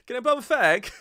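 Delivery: high, loud hammed-up British voice; mock British accent. Mocking the British manager's cigarette request, high and loud. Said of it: "he was British".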